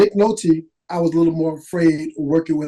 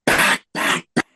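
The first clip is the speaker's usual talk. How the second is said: hushed warning. Retold or imitated. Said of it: "And he goes".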